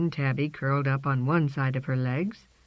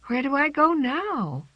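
put-on young cat voice; 11 semitones higher than usual. A narrator's reading. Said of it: "asked herself".

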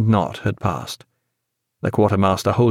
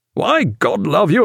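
higher, slow, emphatic cry; hearty exclamation; 6 semitones higher than usual. A narrator's reading. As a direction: exclaim heartily to an old friend. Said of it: "cried Jack".

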